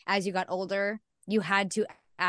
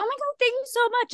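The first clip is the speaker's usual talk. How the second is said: much higher, louder gushing thanks; enthusiastic retail voice; 5 dB louder than usual. Retold or imitated. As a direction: pitch it way up like eager customer service. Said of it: "my voice goes up when I'm being enthusiastic".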